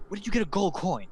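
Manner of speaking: high-pitched, excited question; excited barkeep. Acted. Barkeep spots traveler's gold coin, excitedly. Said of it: "his eyes kinda glow with excitement".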